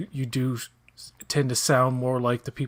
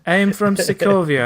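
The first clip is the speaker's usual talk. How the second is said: put-on joking Russian accent, louder and higher; mock Russian accent; 6 semitones higher, 9 dB louder than usual. Cohost teasing that Wanda is Russian, mock Russian accent. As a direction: ham up a Russian accent to tease. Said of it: "a tiny bit of the Russian accent".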